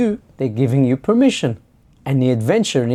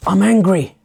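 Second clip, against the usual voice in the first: raised, higher mock-angry outburst; acted anger; 6 semitones higher, 5 dB louder than usual. Complainer testing what is permitted, acted angry outburst. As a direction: burst out louder, testing the limit. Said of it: "they're getting louder".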